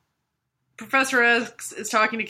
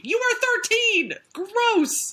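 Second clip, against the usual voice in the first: much higher, mock-appalled cry; disgusted outburst; 13 semitones higher, much slower than usual. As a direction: squeal it like you can't believe it. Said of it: "I'd be like".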